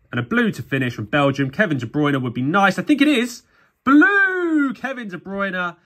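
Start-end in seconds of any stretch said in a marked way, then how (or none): 2.8-5.8 high, slow, pleased announcement; excited. Real, not acted. Pulling the player he hoped for, he announces him with delight. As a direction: draw out the name in delight.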